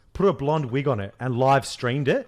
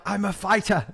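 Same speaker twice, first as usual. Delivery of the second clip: higher-pitched botched impression slipping into Irish; accidental Irish accent; 7 semitones higher than usual. Mocking the British Prime Minister to a co-host, accidental Irish accent. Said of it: "that's an Irish accent".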